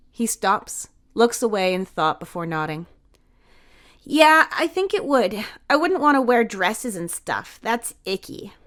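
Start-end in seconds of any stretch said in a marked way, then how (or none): 4.0-8.5 put-on higher, louder, emphatic child; a kid who's sure; little boy voice. A narrator's reading. Jamie telling Tia dresses are icky, loud and certain.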